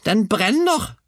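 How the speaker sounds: very high, loud alarm cry; grandmother's bellow. A narrator's reading. Grandmother sees hen smoking, high yell. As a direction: screech the alarm. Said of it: "vrælte bestemor".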